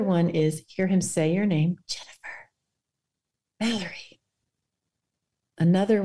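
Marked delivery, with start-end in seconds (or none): 1.9-2.5 soft whispered line. Pretend. Meditation, whispered name. 3.6-4.2 soft name. Pretend. Meditation, softly named.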